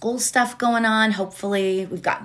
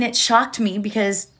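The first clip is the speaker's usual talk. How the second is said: ordinary talk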